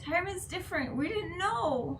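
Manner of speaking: apologetic pleading. Acted. Child pleads with crying father.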